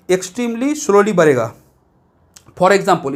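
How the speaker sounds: ordinary delivery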